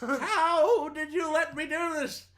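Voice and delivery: very high, hammed-up comedian impression; bad celebrity impersonation; Bill Cosby. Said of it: "the worst Bill Cosby impression".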